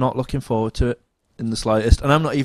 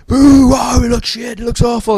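much higher and louder jeering crowd; mock booing crowd; 13 semitones higher, 8 dB louder than usual. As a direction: boo and heckle like a disappointed audience.

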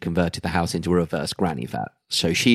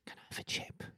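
whispering; 25 dB quieter than usual. Pretend. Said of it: "No, what you really wanted to say was".

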